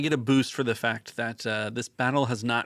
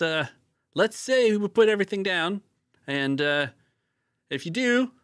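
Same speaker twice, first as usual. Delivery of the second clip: high, loud hammed-up Aussie voice; ridiculous Australian accent; 8 semitones higher, 4 dB louder than usual. Kit offers Grizzle his life in a silly Australian accent. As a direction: lay on the Aussie twang, demanding surrender. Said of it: "try at this Australian accent".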